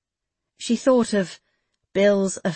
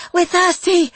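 put-on high, louder breathless child's voice; gasping child; 11 semitones higher, 8 dB louder than usual. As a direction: pant it out like a thirsty kid. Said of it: "gasped Stanley".

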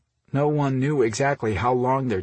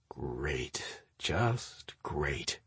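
low, hushed aside to himself; wincing inner thought; 8 semitones lower, 10 dB quieter, much slower than usual. Acted. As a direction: think it under your breath with a wince. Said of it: "he thought with a wince".

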